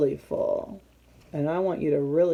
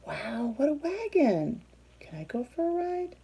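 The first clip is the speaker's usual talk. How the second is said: higher, eager little animal asking; lightly voiced Wombat; 8 semitones higher than usual. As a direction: ask sweetly for a turn. Wombat asks Worm for ride.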